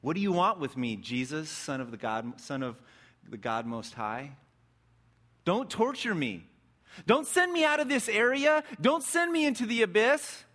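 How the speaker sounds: possessed man crying out to Jesus, building to a high loud plea; pleading demon cry. A narrator's reading. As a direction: beg not to be sent to the abyss. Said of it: "cries out in this voice that sends a shiver".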